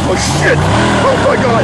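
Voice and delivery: sudden high yelp of shock; jolted by a close call; real fright bursting out loud; startled shout. Real, not acted. Startled as racer flies past.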